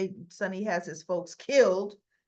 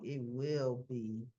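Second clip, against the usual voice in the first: low, slow and soft menace; lower, slow mock threat; hint of a cold gangster; menacing mob boss; 8 semitones lower, 5 dB quieter, slower than usual. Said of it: "Sonny's like".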